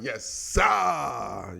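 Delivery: gut-driven Japanese-style yell; mock Japanese accent. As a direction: imitate a Japanese shout from the stomach. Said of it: "they talk with their, with their stomach".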